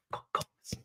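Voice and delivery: breathy whisper of disbelief. Real, not acted. Fan to his viewers about the goals, whispered. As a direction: breathe it out like a secret. Repeats the phrase, then whispers it.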